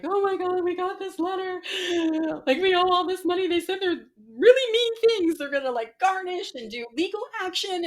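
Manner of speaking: high, loud panic, reenacting a meltdown; acted-out distraught outburst; retold frantic bawling. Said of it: "I just started bawling".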